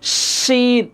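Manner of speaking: drawn-out hushing শিন; inner-mouth shin. Shown as a demonstration. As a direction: say শিন with sh, tongue off the teeth. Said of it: "মাঝামাঝি থেকে".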